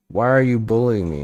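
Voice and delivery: loud, high, hurt cry of protest; bullied elf. Acted. The bullied elf demands why he is picked on, loud and high. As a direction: wail it out like a wounded little elf.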